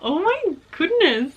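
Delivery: high, slow, awed; breathless joy. Real, not acted. Vlogger still stunned by the signed copy, awed delight. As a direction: let it out in wonder.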